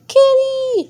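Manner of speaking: sharp high call. Acted. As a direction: shout for the kitty. Calling out for cat.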